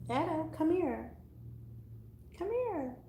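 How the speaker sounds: high, slow coaxing call to a cat; coaxing pet voice. Real, not acted. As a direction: sweet-talk the cat to come. Calling the cat over.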